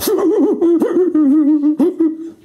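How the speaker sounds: loud, high run of monkey hoots; monkey hooting. Acted. Monkey answering the sergeant's condolences, loud high hooting. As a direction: hoot loudly like a monkey.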